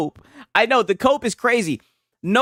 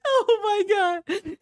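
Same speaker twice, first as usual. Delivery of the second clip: laughing; much slower than usual. Real, not acted. Laughing fit at rival.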